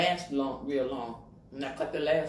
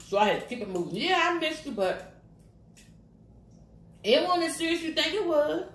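much higher, teasing; sassy mock reply; 10 semitones higher than usual. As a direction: brush her off playfully. Answering imagined daughter teasingly.

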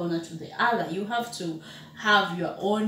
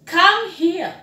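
calling someone; 8 semitones higher, 7 dB louder than usual. Shown as a demonstration. Teacher demonstrating a call.

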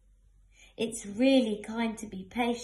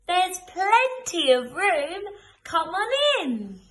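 high, loud, slow open-armed welcome; joyful welcome; 8 semitones higher, 9 dB louder than usual. Acted. Reading to children, welcoming newcomers in gladly. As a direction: beam it out like throwing open the door. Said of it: "But maybe you can say".

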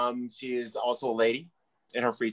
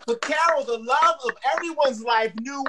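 hype introduction; 10 semitones higher than usual. Real, not acted. Host introduces the next comic.

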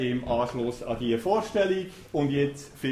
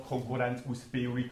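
usual speaking voice

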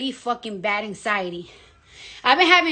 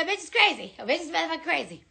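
muttered to herself; 9 dB quieter, much faster than usual. Real, not acted. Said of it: "I'm losing my mind".

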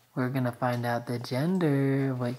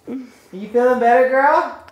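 pet talk; 9 semitones higher, 6 dB louder than usual. Real, not acted. Husband asks the dog if she feels better, high cooing. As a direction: coo at the sick dog.

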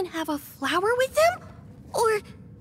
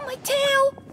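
gasping, rising higher with each discovery; stunned realization; shocked gasps; 8 semitones higher, slower than usual. Real, not acted. Discovering her transformed body.

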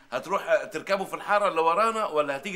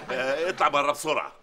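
ordinary talk

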